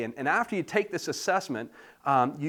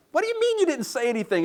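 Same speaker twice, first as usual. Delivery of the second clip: much higher, fast disbelieving outburst; incredulous; 12 semitones higher, 4 dB louder, faster than usual. Retold or imitated. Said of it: "And I'm like".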